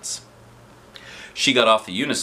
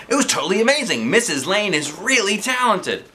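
put-on excited kids; 10 semitones higher than usual. A narrator's reading. Kids praising the teacher.

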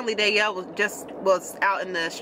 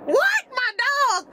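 shocked disbelief; much slower than usual. Retold or imitated. Hearing a stranger has her dog, shrill shocked squeal. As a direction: voice shoots very high on What.

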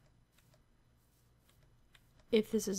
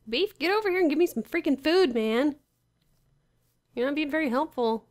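loud, high demand to a teammate; exasperated yelling; 6 semitones higher, 10 dB louder than usual. Real, not acted. Player to unhelpful Beef about needing food, yelling in exasperation. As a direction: call out sharply, pitch jumping up.

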